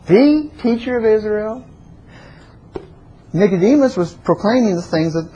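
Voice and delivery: ordinary delivery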